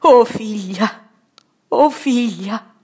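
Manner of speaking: slow, loud, aching lament; grieving exclamation. Acted. Mauro at the deathbed of Donna Caterina, slow grieving cry. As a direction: cry it out over the deathbed, slowly. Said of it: "esclamò".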